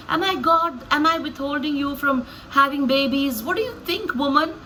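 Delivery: high, indignant acted retort; angry retort. Jacob answering Rachel's demand, angry and indignant. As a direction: throw the questions back angrily, pitch climbing. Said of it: "Jacob is angry".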